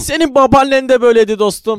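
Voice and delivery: dubbing actor impression. Shown as a demonstration. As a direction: project it like a dubbed film buddy chiding a friend. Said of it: "Biraz Mustafa gibi olur musun". (abartılı, yüksek sesli dublaj taklidi)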